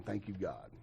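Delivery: very soft, low thanks to God; hushed prayer. Retold or imitated. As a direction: breathe out a retold prayer, barely voiced. Retold whispered prayer in awe.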